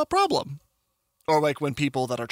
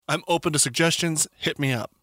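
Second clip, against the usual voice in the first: usual speaking voice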